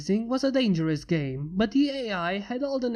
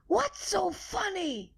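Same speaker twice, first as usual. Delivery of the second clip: mock yell; 11 semitones higher, 4 dB quieter, slower than usual. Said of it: "yelled Icy".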